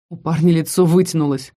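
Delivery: ordinary delivery, nothing marked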